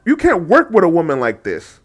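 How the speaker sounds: ordinary delivery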